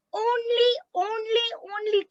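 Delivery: "only" said high, repeated three times; emphatic repeated "only"; stress on only. Shown as a demonstration. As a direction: hit each "only" high. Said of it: "stressing only".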